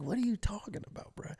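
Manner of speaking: high, soft baffled question; confused. Retold or imitated. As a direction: imitate innocent bewilderment. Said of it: "you're just, like, confused".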